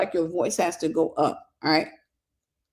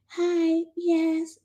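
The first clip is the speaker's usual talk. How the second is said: much higher, soft light mom voice; imitating soft-voiced mom; 9 semitones higher than usual. Speaker imitates her soft-voiced mother: high light voice. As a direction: lightly copy her gentle voice. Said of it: "I can actually sound just like my mother".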